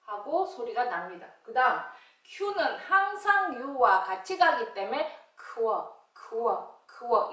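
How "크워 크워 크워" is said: "크워" as a k gliding into a rounded wo; QU sound demo. Shown as a demonstration. Teacher showing Q always goes with U, sounded out separately. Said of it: "이렇게요".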